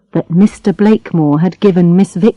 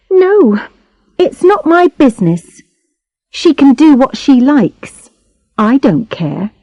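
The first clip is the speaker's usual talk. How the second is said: couldn't-care-less character voice; dismissive girl; 5 semitones higher than usual. A narrator's reading. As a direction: brush it off in a higher girlish voice. Girl brushes off narrator's question.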